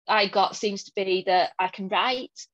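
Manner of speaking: normal talking voice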